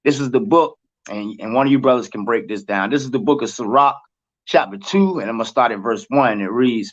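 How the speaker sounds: ordinary talk, nothing marked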